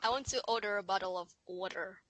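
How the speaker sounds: low, soft American accent; failed British attempt that comes out American. Shown as a demonstration. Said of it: "that's American".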